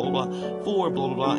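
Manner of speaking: normal talking voice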